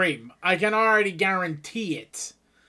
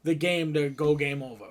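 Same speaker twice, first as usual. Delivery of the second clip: ordinary delivery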